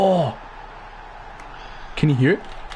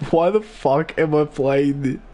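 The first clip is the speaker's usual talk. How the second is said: usual speaking voice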